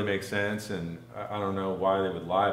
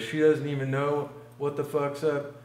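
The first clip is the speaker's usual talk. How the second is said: higher-pitched mock Southern man; Southern accent; 5 semitones higher than usual. Storyteller as the man complaining about his girlfriend, Southern accent. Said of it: "they both had Southern accents".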